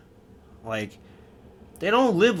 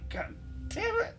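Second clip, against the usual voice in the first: pained groan; 11 dB quieter than usual. Retold or imitated. Just kicked in the nuts.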